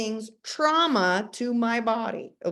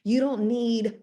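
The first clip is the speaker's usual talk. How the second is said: ordinary delivery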